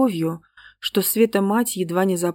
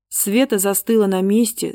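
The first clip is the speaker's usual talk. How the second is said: ordinary delivery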